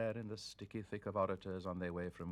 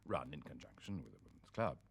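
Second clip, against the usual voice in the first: ordinary delivery, nothing marked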